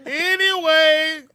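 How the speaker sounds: high drawn-out laugh and anyway. Real, not acted. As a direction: laugh it off slowly.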